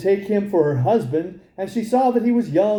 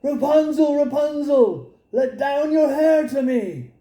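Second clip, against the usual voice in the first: high hopeful call up the tower; 8 semitones higher than usual. Acted. King's son calling up to Rapunzel at dusk, high call. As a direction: call out high, copying the witch's call. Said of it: "he went to the tower and cried".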